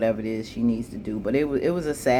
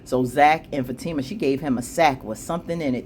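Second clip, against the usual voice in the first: ordinary delivery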